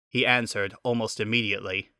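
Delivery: usual speaking voice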